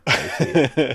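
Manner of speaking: high, loud hype MC shout; big up someone like a pirate radio MC. Pretend.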